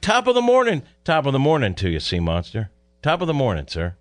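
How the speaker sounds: mock Irish brogue, high and bright; cheery stage-Irish greeting; Irish accent. Teasing the boss in a mock Irish accent. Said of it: "he's like Tommy Smith's accent".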